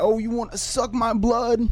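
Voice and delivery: high, teasing mock voice of a guy cracking up; a lightly hammed-up Italian moviegoer mocking the vampire; mocking dude. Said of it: "dudes would just crack up and be like".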